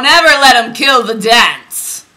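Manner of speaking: loud, high mock-indignant cry; playful outcry. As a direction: cry it out like a hammed-up protest. Mock outcry after reading dancing line.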